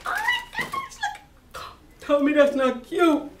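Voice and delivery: high, delighted squeal; gushing. Real, not acted. Teacher gushing to viewers over a cute pencil topper. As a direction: coo over how cute it is.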